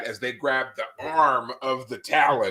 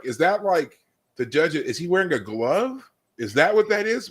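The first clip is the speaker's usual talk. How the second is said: surprised question; 7 semitones higher than usual. Retold or imitated. Retold confused first reaction.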